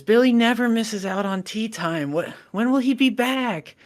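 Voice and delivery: put-on high, plaintive child voice; crying little girl. Crying girl asks mom when Billy returns, high and plaintive. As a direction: wail it like an upset little girl. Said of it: "she starts crying".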